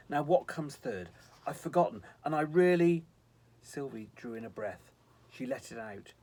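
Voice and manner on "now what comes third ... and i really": louder, rising fluster; muddled angel. A narrator's reading. Visitor trying to recall his instructions, muddled and flustered. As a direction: work yourself up as you lose track. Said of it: "muddled, shutting his eyes".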